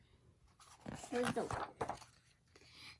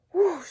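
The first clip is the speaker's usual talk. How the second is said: magic whoosh; 3 semitones higher, 11 dB louder than usual. Acted. Magic wand sound.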